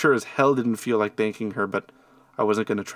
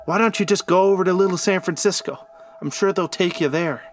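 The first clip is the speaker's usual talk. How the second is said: loud, raised-pitch angry call; angry yell; 7 semitones higher, 4 dB louder than usual. A narrator's reading. Innkeeper yelling at strangers in the corner, angry and loud. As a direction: yell it across the room. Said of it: "get angrier and yelled to the corner".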